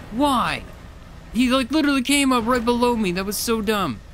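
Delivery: high, loud, upset venting; exasperated outburst. Real, not acted. Venting loudly to herself after the fish wrecks her boat. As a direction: complain at the unfair loss.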